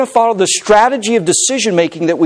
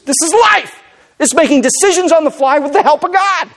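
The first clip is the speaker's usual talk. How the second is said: high, ringing preacher's cry; emphatic, high-pitched sermon climax; impassioned preaching; 12 semitones higher than usual. Real, not acted. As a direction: proclaim it with rising fervor. Preaches at full force.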